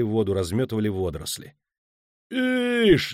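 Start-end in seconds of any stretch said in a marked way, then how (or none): 2.3-3.1 high, long-held indignant cry; furious high shout; outraged yell. A narrator's reading. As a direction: holler it out, stretching the vowel. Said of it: "заорал Кобин".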